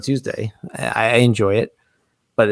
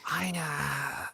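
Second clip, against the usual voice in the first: whisper; 4 semitones higher, 14 dB quieter than usual. Real, not acted. Embarrassed aside.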